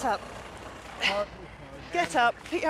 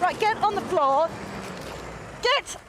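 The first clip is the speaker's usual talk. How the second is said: shouting; 6 semitones higher than usual. Real, not acted. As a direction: yell the command. Second officer restraining suspect.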